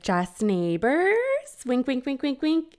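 slow, knowing "just neighbors", then rising winks; teasing mom. Retold or imitated. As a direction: tease her daughter with sing-song winks. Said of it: "And she's like".

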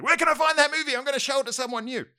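hammed-up drunk mate on the phone; drunk friend. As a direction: play the tipsy mate ringing late at night. Drunk friend phoning him up.

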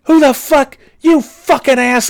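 high, loud road-rage scream; retold screaming. Driver cut off in the drive-thru, hammed-up furious scream. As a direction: re-enact screaming at the driver ahead. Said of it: "I'm just, like, screaming".

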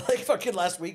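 ordinary talk, nothing marked

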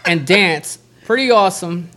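ordinary delivery

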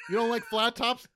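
very loud, high accusing shout; imitating yelling dad. Retelling dad yelling about flat tops, accusing shout. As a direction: yell the question like an offended father. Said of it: "when he yelled at me".

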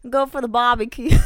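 loud hammed-up Aussie, amused; mock Australian accent. Co-host joking about what Australians say, loud mock Australian accent. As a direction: put on a silly Australian accent, loudly. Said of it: "like fucking Australians?".

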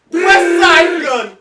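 loud, high, stretched-out hype call; drawn-out shout. Pretend. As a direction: holler it long like a rap hype man. Calling out rapper's name, then apologizes.

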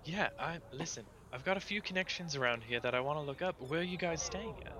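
acted eager offer of connections; eager Lady Bianca. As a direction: perk up high at the reminder. Game master voices Lady Bianca.

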